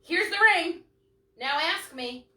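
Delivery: high, loud mocking demand; mock demanding girlfriend. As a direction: mimic a pushy partner. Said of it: "Not to go and say".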